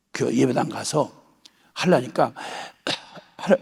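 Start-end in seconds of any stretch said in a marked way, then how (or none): 3.4-3.5 faltering prayer. Retold or imitated. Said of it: "막 울면서".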